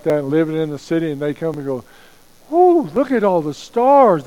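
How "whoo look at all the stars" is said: high, excited gasp of wonder; awed kids. Retold or imitated. Camp kids from Dallas seeing the stars, excited awe. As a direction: play the awestruck city kid seeing the sky.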